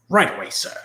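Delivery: British butler. Acted. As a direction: answer like an eager English manservant. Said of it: "Alfred will be like".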